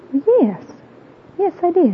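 surprised reply. Retold or imitated. Retold lady surprised by question.

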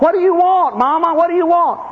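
high, loud urgent begging question; desperate pleading son. Retold or imitated. Son at his dying mother's bedside, pleading high and loud. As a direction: beg her to say what she needs.

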